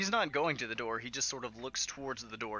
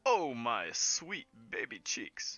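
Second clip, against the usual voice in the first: doting showman Yolo, teasing; 2 semitones higher, 6 dB louder than usual. Acted. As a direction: flatter your love with theatrical flourish. Game master voices Yolo.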